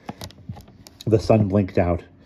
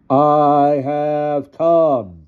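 loud, very slow, raised ghostly cry; booming apparition; 8 semitones higher, 7 dB louder, much slower than usual. A narrator's reading. Disguised Tycho appears before the children, crying out slowly. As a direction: intone it grandly, stretching each word. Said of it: "Tycho cried out".

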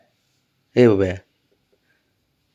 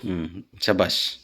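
soft praise; 6 semitones lower, 9 dB quieter, slower than usual. Real, not acted. Praising pet softly.

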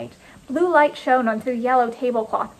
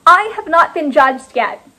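loud, high, indignant shout; forceful, raised-voice demand; top-volume announcement; 5 semitones higher, 8 dB louder than usual. A narrator's reading. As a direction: shout it over a whole crowd. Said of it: "I raised my volume as high as it would go".

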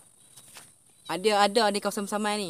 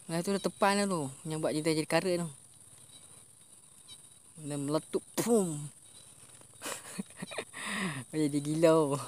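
ordinary delivery

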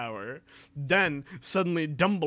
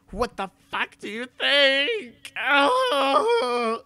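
higher and slow, mock furious; hammed-up angry snap; 11 semitones higher, 4 dB louder, much slower than usual. Reading the fanfic aloud, Ebony hammed-up and furious. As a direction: spit it out like an angry goth girl. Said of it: "I asked angrily".

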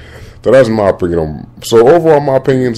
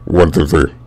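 ordinary talk

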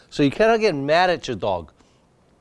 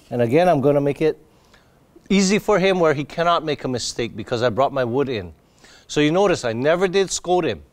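normal talking voice

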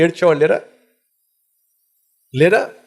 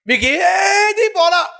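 drawn-out high preaching cry; much slower than usual. Real, not acted. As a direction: wail the question slowly to the congregation. Preacher cries out rhetorical question.

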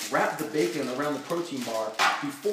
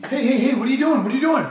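alarmed; 11 semitones higher, 11 dB louder, much faster than usual. Acted. Alarmed cooking argument.

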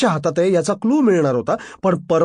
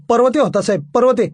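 flustered answer; 5 semitones higher, 4 dB louder than usual. Acted. Subordinate answers angry boss, flustered.

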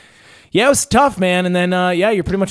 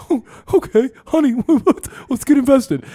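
naive convert voice; 10 semitones higher than usual. Retold or imitated. Customer telling her husband to invest, higher and starry-eyed. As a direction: sound like a starry-eyed new recruit. Mocking a convinced buyer.

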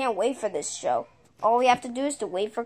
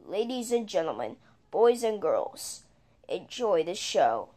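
soft, quick announcer intro; show announcer; 3 semitones lower, 5 dB quieter than usual. Acted.

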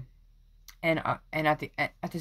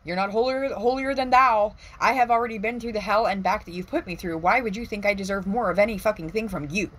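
indignant rant; 6 semitones higher, 3 dB louder than usual. Retold or imitated. Venting at imagined enemies.

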